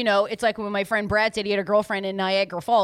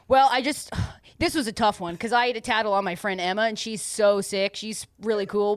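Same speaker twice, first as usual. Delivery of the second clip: normal talking voice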